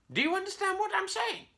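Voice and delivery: very high squawky parrot echo; parrot repeating. A narrator's reading. Parrot echoes Curly's question in a squawky high voice. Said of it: "the parrot said".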